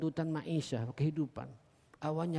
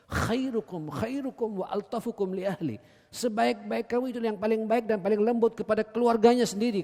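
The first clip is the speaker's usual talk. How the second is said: preaching; 7 semitones higher, 6 dB louder than usual. Acted. Hadith then translation.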